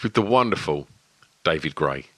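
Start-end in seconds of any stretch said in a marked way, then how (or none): none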